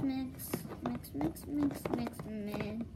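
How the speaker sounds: whispered stirring chant; whispering. Acted.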